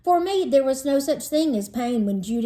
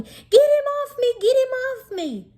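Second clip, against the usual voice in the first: high, loud panicked yelling; 4 dB louder than usual. Acted. Boy on his desk yelling to get the spider off. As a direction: shriek it as a spider lands in your lap. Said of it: "tap dancing and yelling".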